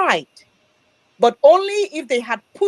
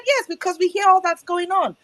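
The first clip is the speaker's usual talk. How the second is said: lightly voiced friend, higher; quoting the friend; 7 semitones higher than usual. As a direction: pitch the friend's reply up, explaining. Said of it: "He said".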